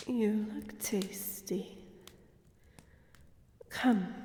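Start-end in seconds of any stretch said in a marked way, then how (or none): 0.1-1.8 slow, whispered, savoring; predatory whisper; hungry whisper. Acted. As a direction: whisper it with appetite. Vampire eyes her prey.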